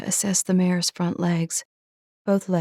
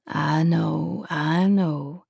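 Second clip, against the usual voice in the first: gentle; much slower than usual. A narrator's reading. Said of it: "he said gently".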